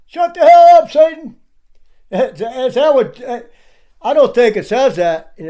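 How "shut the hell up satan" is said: high, loud mocking yell; mocking shout. Preacher mocking Stair's yell, high and loud. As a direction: shout it to parody his profanity. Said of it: "Is that how Paul talked to the saints?".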